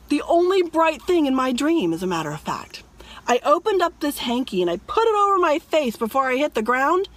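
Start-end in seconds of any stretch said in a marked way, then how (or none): 1.9-2.8 hushed, confiding aside; whispered aside. Real, not acted. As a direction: drop to a whisper. Murmured aside to the viewers.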